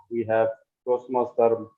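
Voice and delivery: usual speaking voice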